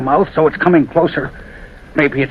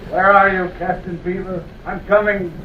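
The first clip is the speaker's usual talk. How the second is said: high, slow calls sent far into the dark; calling into night; 8 semitones higher, slower than usual. Acted. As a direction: call out into the empty night, searching. Calling the ghost on tracks.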